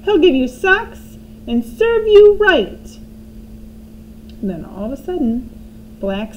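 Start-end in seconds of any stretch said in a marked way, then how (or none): 0.0-3.0 high, loud crone scolding, slowing at the end; grandma scolding the boy, high and loud. A narrator's reading. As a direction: threaten him like a spiteful old woman.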